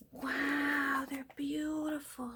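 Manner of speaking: high, whispery wonder; awed admiration. Real, not acted. Crafter admires beautiful old stickers, awed admiration. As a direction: breathe it out high, marvelling.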